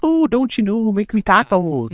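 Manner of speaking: Midwestern impression with a high, put-on voice; Midwestern accent. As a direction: hams up a Minnesota twang. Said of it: "They're like".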